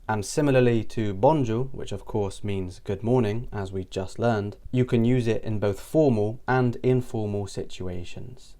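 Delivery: ordinary delivery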